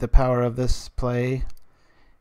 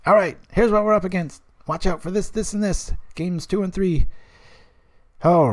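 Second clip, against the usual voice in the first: higher, briefing-style call-out of threats; imagined deck checker; 10 semitones higher than usual. Retold or imitated. Streamer imagining a deck checker for the metagame, briefing. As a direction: play a brisk helper warning what to watch. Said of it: "Something that's like".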